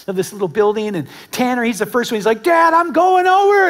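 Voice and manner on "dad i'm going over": put-on high, loud, thrilled shout of a boy; excited kid call. Son telling dad he is jumping, excited kid call. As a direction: yell it out before the leap.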